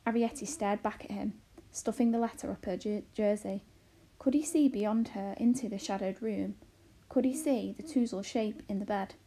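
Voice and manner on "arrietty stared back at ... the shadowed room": ordinary delivery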